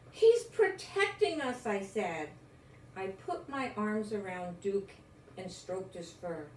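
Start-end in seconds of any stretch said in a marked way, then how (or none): 0.1-1.7 higher, louder eager cry; excited exclaim. Acted. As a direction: announce it with thrilled pride. Child exclaims about the dog.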